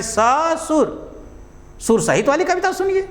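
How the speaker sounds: ससुर split as a long, lifted स then सुर; stress on ससुर. Shown as a demonstration. Rival poet defending his pun, stress on ससुर. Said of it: "तो यह होता है बलाघात".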